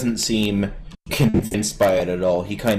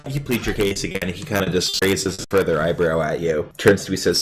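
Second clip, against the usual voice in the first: normal talking voice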